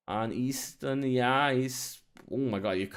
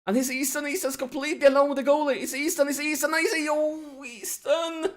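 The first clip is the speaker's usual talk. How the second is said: high, loud, racing goal-chance call; frantic excitement; 13 semitones higher, 6 dB louder than usual. Real, not acted. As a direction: build frantically into an anguished cry on the name. Striker alone with the goalie.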